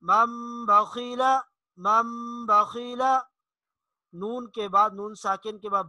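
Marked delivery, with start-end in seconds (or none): 0.0-3.2 मम बखीला slow with nasal म; slow recitation. Shown as a demonstration. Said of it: "मसलन". (मम बखीला गुन्ना के साथ)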